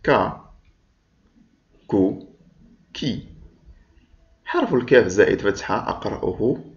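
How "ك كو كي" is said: ك with a short a, كو with a short u, كي with a short i; short vowels demo. Shown as a demonstration. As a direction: model each short vowel clearly. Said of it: "أعد معي حرف الكاف مع الحركات القصيرة". (نطق الكاف مع الحركات القصيرة)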